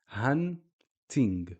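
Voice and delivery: "hunting" slowly, hun, pause, ting; no added vowel. Shown as a demonstration. Teacher showing the N stop, slow split word. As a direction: hold apart "hunting" at the N. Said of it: "not adding any vowels afterwards".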